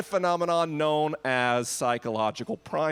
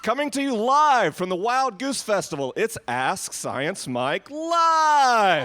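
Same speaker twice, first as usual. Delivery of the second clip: booming announcer, higher and louder, drawing out the last word; super big radio voice; 6 semitones higher, 5 dB louder, slower than usual. Pretend. Podcast host to festival crowd, big radio announcer voice. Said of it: "a super big radio voice that says".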